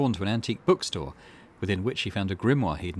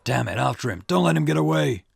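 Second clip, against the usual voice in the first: louder, higher, barking at the officers; rally the men to chase him; shouted order; 5 semitones higher, 6 dB louder than usual. Acted. Officers chase fleeing suspect.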